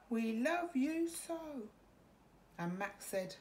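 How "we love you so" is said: high, slow, tender plea; tender monster plea. A narrator's reading. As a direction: beg him gently to stay. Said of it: "But the wild things cried".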